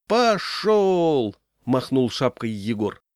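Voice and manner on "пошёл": high, projected work call; hauling command. A narrator's reading. Leader signalling men to pull the ropes, calling out.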